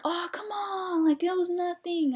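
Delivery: high, drawn-out mock whine; whiny, exasperated high-pitched objection; upset protest. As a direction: imitate players complaining about the call. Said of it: "got upset".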